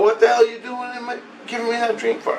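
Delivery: high, retold indignant question; indignant demand. Man confronting the voice behind his dream, indignant. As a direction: challenge him in a high, hurried protest. Acted quarrel with the voice.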